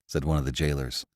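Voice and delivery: ordinary delivery, nothing marked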